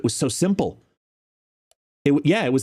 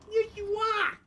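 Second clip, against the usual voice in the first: squeaky pet talk; 7 dB quieter than usual. Real, not acted. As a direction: squeal it adoringly to a dog. Baby talk to the dog.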